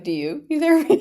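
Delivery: high, loud imitation of others; hammed-up retold question; mock concerned question. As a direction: pitch it way up, teasingly incredulous. Mimics people's judging question, laughing.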